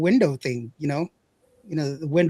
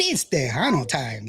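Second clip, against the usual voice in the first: slower, lower, drawn-out catchphrase; slow tag line; 3 semitones lower than usual. Acted. Host answering his own question with a slow, settled catchphrase. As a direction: lay the slogan down slow and settled.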